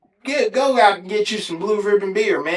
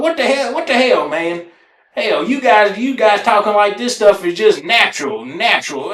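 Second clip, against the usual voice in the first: going off; 3 semitones higher than usual. Retold or imitated. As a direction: tear into them, indignant. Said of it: "I went the hell off on them".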